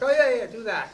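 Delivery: high, loud breezy yes-yes; mock eager agreement. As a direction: fake confidence, like he knows. Pretending to understand crew jargon.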